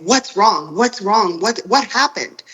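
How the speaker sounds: mildly panicked questions, rising in pitch; urgent repeated questions, pitch climbing; retold alarm. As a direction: imitate someone bursting in worried. Husband rushing in alarmed, retold.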